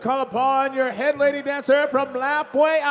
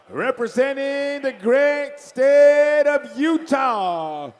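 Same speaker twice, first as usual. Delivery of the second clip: big announcer intro; 3 dB louder, slower than usual. Real, not acted. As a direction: build it up and belt the name. Announcer introduces Miss Indian World.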